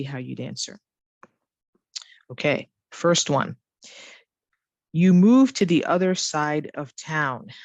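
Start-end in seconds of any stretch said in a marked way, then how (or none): 4.9-7.5 DIDN'T YOU falling at the end; falling question tag. Shown as a demonstration. Teacher reads the first one, falling question tag. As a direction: let "didn't you" drop after a slow statement. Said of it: "My voice actually should go up".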